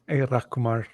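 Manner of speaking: ordinary delivery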